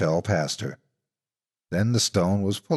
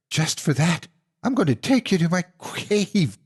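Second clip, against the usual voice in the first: higher, snarling monster threat; growling monster; 9 semitones higher than usual. Acted. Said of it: "she growled".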